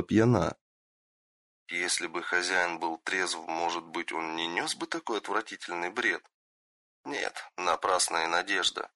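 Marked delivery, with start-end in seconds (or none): 1.7-8.9 much softer, weary inner thoughts; quiet inner voice. Acted. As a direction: murmur it to yourself in disgust. Shinobi's silent disgusted thoughts.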